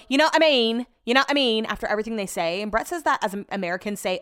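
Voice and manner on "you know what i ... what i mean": higher, louder mock English accent. Podcaster mimicking Love Island contestants, mock English accent. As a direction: imitate the English catchphrase, pitched up and punchy. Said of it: "I do the worst English accent".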